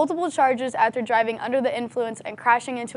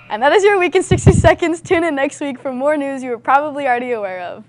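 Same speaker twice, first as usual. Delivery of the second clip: upbeat sign-off; 2 semitones higher, 6 dB louder than usual. Acted. Upbeat sign-off of news segment.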